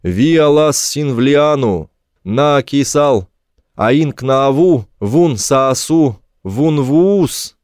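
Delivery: loud, slow, drawn-out foreign call; slow ringing shout. A narrator's reading. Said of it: "Я прокричал".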